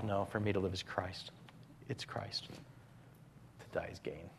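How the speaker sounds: fading to a whisper. Acted. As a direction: let the verse die away. Closing verse fading to whisper.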